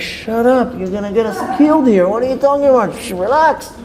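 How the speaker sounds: high, urgent retold shushing; acted nervous scolding; alarmed hushing. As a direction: hush a noisy stranger in mild panic. Retold frantic hushing of shouting stranger.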